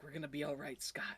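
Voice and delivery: a hushed, broken mock comfort. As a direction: murmur it like a man in shock. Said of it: "he's like".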